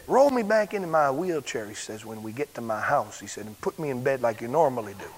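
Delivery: healed man asking to be put to bed, softly; hushed. Retold or imitated. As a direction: keep the secret, softly. Don's quiet request.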